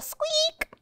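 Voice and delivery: very high, drawn-out squeak; squeaky wheel. A narrator's reading. Bike wheels turning during the chase, squeaking high and long. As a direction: stretch the word into a high squeal. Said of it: "The wheels of his bike went".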